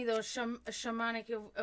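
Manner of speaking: ordinary talk, nothing marked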